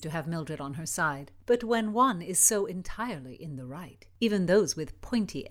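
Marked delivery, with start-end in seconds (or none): none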